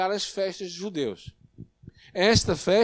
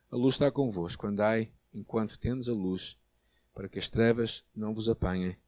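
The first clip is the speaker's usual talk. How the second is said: low, soft, solemn Bible reading; subdued scripture reading; 6 semitones lower, 6 dB quieter than usual. Real, not acted. Preacher reading Jesus' words about the light, hushed scripture reading. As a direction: bring the reading down to a quiet close.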